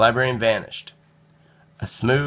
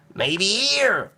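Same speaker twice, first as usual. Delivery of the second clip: high hopeful call; boy calling into a circus parade; 10 semitones higher than usual. A narrator's reading. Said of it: "called Nicholas".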